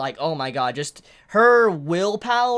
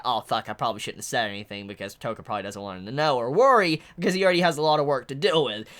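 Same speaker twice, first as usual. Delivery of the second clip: rushed low mutter; 10 semitones lower, 4 dB quieter, faster than usual. Retold or imitated. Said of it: "Nishiki's like".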